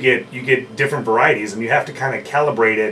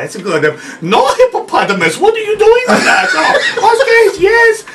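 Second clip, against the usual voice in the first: high, loud hammed-up Hong Kong skipper; Hong Kong accent; 8 semitones higher, 5 dB louder than usual. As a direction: play the giggling Hong Kong ride guide, going higher and louder. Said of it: "The Chinese accent?".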